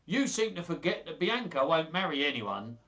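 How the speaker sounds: swaggering proclamation; grand. Acted. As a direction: call the room to order pompously. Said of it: "Petruchio said grandly".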